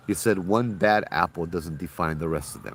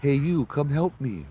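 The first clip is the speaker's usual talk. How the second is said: put-on high childlike plea, slower at the end; little girl's voice; 8 semitones higher, slower than usual. The same ghost child's voice repeating, high and pleading. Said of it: "we hear the same voice again".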